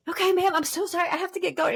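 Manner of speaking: high, sweet customer-service voice, cutting the call short; polite hurried phone voice. Retold or imitated. Daughter rushing a caller off the payphone, high polite phone voice. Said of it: "And I'd be like".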